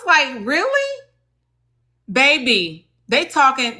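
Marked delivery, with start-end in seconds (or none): 0.5-1.0 high-pitched, drawn-up "really?"; retold squeak of disbelief; incredulous. Acting out her disbelieving reaction.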